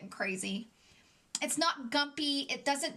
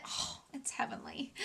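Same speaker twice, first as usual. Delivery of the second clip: whispered, much softer; dreamy whisper; 7 dB quieter than usual. Real, not acted. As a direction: breathe it like pure bliss. Swooning over lip combo.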